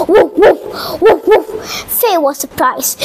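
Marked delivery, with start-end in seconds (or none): 0.1-1.5 short imitated dog woofs; dog bark. Frog who barks like a dog, barking. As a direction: bark in quick paired bursts. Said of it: "he barked like a dog".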